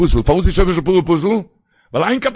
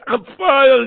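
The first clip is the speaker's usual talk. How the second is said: raised, higher alarm cry; fire shout; 6 semitones higher, 3 dB louder than usual. Retold or imitated. Preacher acts the watchman crying fire to the congregation. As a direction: shout it like a sudden warning. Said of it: "man schreit".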